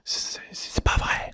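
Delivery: whispered c'est pas vrai, ASMR style. Shown as a demonstration. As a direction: hush it as a despairing whisper. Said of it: "je vous la fais en A S M R".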